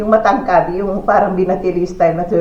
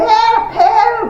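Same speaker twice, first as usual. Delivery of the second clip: very high mock cry for help; imitating a child yelling; 12 semitones higher than usual. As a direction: squeal it like a frightened kid.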